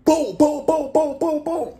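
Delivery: loud, high mock gunfire booms; gunshot sounds. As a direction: shout out the shots like a gun going off. Imitating gunshots in the story.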